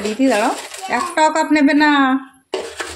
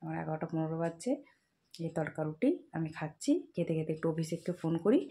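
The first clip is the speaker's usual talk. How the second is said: hushed narration; 6 semitones lower, 14 dB quieter than usual. Real, not acted. Speaks softly while baby sleeps. (ঘুমন্ত শিশুর পাশে চাপা গলায় কথা)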